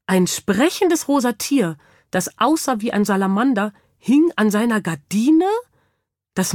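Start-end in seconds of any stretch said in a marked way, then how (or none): none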